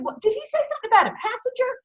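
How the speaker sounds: high, fast, puzzled captain; captain straining to hear; captain's voice. Acted. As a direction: rattle it off like a confused old skipper. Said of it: "He said".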